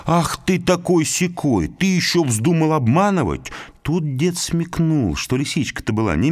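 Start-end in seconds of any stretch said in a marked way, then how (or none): 0.0-3.5 scolding wife. Acted. Old woman scolding husband over the missing fish, indignantly. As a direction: scold the husband for tricking her. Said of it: "и начала ругать мужа".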